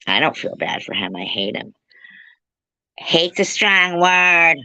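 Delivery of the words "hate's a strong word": slow, higher put-on voice; imitating Crystal Love. Mocking a friend, slow and higher voice. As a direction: drag out her words. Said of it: "That's my imitation of Crystal Love".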